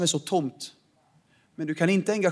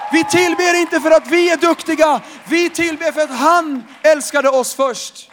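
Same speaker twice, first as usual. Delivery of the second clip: full-force preaching; 9 semitones higher, 6 dB louder than usual. Acted. Pastor thundering to the church about true worship. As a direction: proclaim it as a rousing climax.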